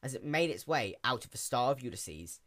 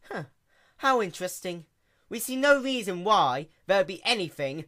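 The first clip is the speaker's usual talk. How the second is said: lofty princess, louder and higher, amused; amused royal Luna; 7 semitones higher, 7 dB louder than usual. A narrator's reading. Princess scoffs at the sea pony's claim in an amused royal voice. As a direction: humour the silly subject regally.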